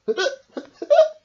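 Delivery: high loud hiccup giggle; hiccup squeak. A narrator's reading. Shark's tummy jumping, loud squeak.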